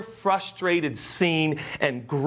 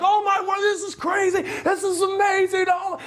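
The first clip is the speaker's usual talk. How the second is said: high, loud astonished shout; amazed crowd; 13 semitones higher, 4 dB louder than usual. Retold or imitated. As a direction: cry out like a stunned onlooker. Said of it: "They're all like".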